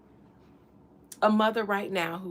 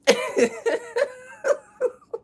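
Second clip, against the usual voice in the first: shrieking high laugh; high laughing squeal; 4 dB louder than usual. Real, not acted. Streamer cracking up at her own voice, high squealing laugh. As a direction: let a high cackle escape.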